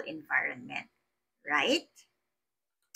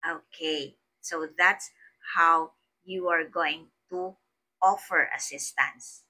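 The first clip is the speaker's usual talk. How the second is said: normal talking voice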